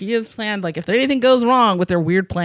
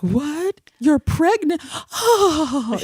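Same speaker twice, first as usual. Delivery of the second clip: high, gasping overreaction; hammed-up sitcom astonishment; mock fainting shock; 9 semitones higher, much slower than usual. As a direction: gasp in disbelief, then swoon. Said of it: "People just faint".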